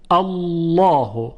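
"الله" slow, with a long, heavy double l; full, rounded lam in "الله"; thick lam. Shown as a demonstration. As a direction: stretch "الله" as a dark "Allawhu".

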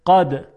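قد with a firm stopped ق; hard qaf. Shown as a demonstration. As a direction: release the ق of قد sharply. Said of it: "با قاف". (تلفظ قد با قاف شدید)

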